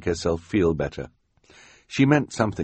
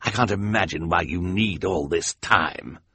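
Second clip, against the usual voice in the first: grumpy villain. Acted. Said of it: "Count Olaf's grumpy reply".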